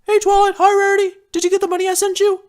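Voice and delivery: put-on bright boyish dragon; Spike voice.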